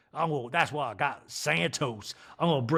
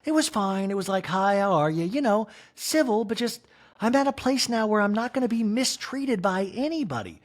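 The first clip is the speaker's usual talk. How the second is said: higher mock Kyle voice; lightly voiced Kyle; Kyle impression; 8 semitones higher than usual. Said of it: "Kyle, in this scene goes".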